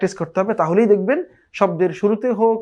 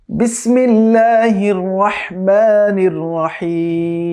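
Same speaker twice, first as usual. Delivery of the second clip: slow, drawn-out chanted tilawat; Quran recitation; much slower than usual. Shown as a demonstration. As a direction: recite it slowly and melodically. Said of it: "তো আমরা শুরু করছি". (ধীর সুরেলা তিলাওয়াত)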